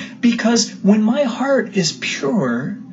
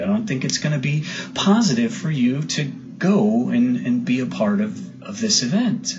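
soft, hushed motherly no; gentle mother; 5 semitones lower, 5 dB quieter than usual. Retold or imitated. Mother gently refusing her son the concert, soft and low. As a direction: soften it, let her down gently.

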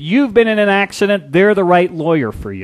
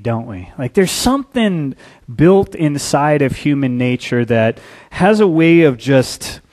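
ordinary talk

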